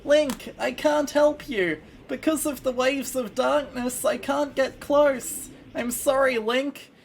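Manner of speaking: high, tiny fairy voice; squeaky little fairy sidekick. Acted. Fairy companion apologizes to Link.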